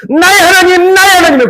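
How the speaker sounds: high, loud confession with a crying break; tearful confession. Acted. Quoting a believer confessing to God, loud and tearful. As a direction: plead it out, voice breaking. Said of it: "고백하고 있어요".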